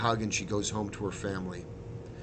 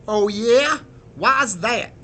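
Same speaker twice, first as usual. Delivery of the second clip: loud, raised-pitch grumble; grumbling; 11 semitones higher, 11 dB louder, much slower than usual. A narrator's reading. Sergeant questions the resistance captain, loud grumble. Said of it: "the sergeant grumbles".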